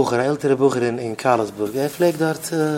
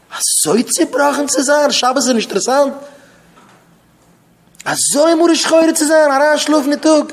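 high, loud outraged scolding; acted scolding; 10 semitones higher, 5 dB louder than usual. Speaker berates someone sleeping on Shabbos, loud scolding.

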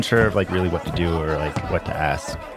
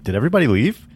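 surprised; 9 semitones higher, 6 dB louder, faster than usual. Retold or imitated. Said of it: "they're like".

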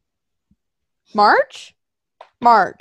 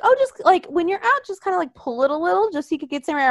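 much higher, fast, sweetly helpful; 7 semitones higher, faster than usual. Retold or imitated. Sister-in-law offering mask advice, high and chirpy. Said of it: "my brother's wife was like".

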